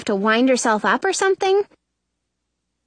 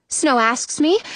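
ordinary delivery

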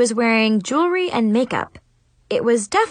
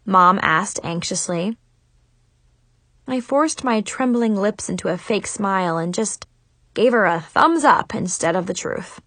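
ordinary delivery

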